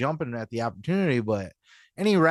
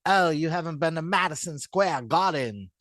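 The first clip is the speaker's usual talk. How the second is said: mock British accent, a touch higher; 4 semitones higher than usual. Mocking dismissive WWE people in a British accent. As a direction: sniff it out in a bad British accent. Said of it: "It's the best British accent I could do".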